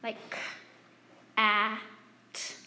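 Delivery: each sound said apart, k, a, t, with pauses; teacher sounding out "cat" letter by letter. Shown as a demonstration. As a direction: spell "k, a, t" out sound by sound. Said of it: "I'm gonna just demonstrate for you".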